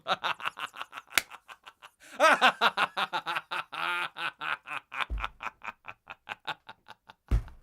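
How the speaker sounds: long loud burst of laughter; real laughter after the bit. Real, not acted. Cracking up at his own April Fools bit, loud laughter.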